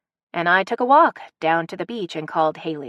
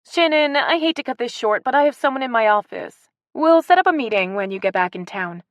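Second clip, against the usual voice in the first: higher, louder brisk office greeting; business tone; 7 semitones higher, 4 dB louder than usual. A narrator's reading. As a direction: switch to greeting a visitor. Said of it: "Haley's tone switched to business".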